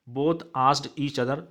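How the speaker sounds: each word spaced out slowly for writing; slow dictation. Shown as a demonstration. Teacher dictating to students, very slow and deliberate. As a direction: dictate it word by word so the class can copy. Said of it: "teacher dictates English answer".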